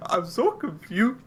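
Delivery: high, slow teasing squeak; mock cracked voice. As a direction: mimic a friend's cracked voice. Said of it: "The way that you said it".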